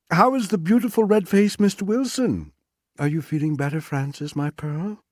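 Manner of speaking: high, bright teasing woman's voice; lightly voiced wife, mock sweet. A narrator's reading. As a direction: tease him sweetly.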